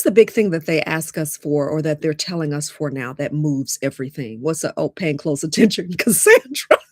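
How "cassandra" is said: loud, high, cracking up on the name; amused disbelief breaking into laughter; laughing. Real, not acted. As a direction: burst out laughing as you call to her. Cracks up at viewer comment.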